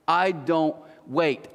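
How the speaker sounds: spaced out, word by word; slow emphasis. Acted. Preacher driving home impatience to the congregation, slow and emphatic. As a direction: draw it out slowly for emphasis. Slows down to drive point home.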